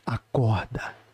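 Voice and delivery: very low, drawn-out call; lightly voiced otherworldly command; imitated woman's voice. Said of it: "muito alto uma voz de mulher".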